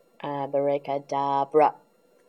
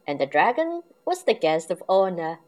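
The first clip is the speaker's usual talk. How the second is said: plain reading voice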